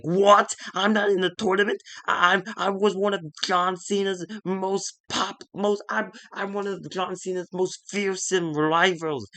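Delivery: a wrestler storming at his boss, half-acted; outraged disbelief. Rusev outraged at being left out.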